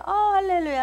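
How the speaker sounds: high, elated mock hallelujah; gushing retold cry of praise; overjoyed praise. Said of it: "할렐루야 막 좋았어요".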